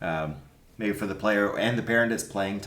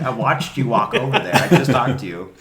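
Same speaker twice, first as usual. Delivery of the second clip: exasperated; 3 semitones higher, 7 dB louder than usual. Retold or imitated. Coach calling out the dad at the fence, exasperated and loud. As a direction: call him out, half laughing at it. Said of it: "I, I'm like".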